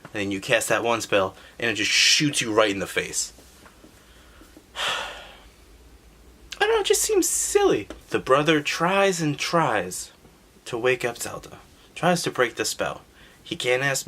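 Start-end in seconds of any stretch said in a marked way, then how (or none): 4.8-7.9 much higher, slow whiny aside; silly high voice. Acted. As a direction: squeak it out in mock exasperation. Exasperated aside after sigh.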